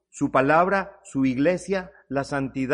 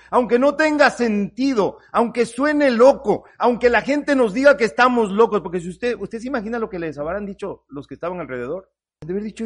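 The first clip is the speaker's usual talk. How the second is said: ordinary talk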